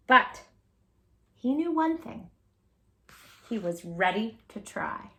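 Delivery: put-on reading a picture book aloud to kids; gentle storytime narration; storybook narration. A narrator's reading. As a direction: tell it like a read-aloud story for children.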